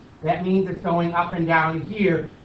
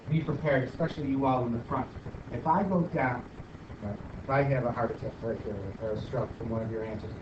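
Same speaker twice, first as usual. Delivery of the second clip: normal talking voice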